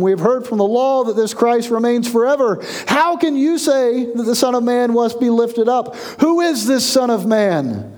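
pitched well up as the doubting crowd; crowd challenging. Acted. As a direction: voice the crowd's challenge, high and pressing. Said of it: "So the crowd answered him".

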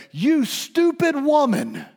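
high, loud, slow contemptuous jab; scornful insult. Retold or imitated. Disciples scorning the woman, loud and contemptuous. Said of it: "To quote Alistair Begg".

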